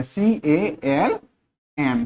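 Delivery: सीएएलएम spelled slowly as C, A, L, M; spelled out letter by letter. Shown as a demonstration. Teacher spelling सीएएलएम slowly for students. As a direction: spell सीएएलएम out for the class, one letter at a time. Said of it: "spelling देखिए उसकी क्या है".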